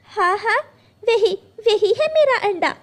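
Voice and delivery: high, eager yes yes; excited. Acted. The sparrow hears the crow has her egg, excited. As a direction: grab at the hope in joy.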